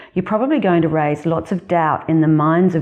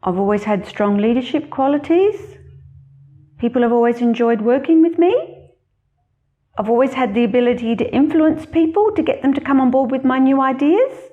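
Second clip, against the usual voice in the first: every statement pitched higher and lilting up at the end like a question; rising intonation; 9 semitones higher than usual. Shown as a demonstration. Job candidate listing strengths to an interviewer, upspeak on every line. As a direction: sound unsure of yourself, ending each sentence on an upward question. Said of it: "If you answer this way".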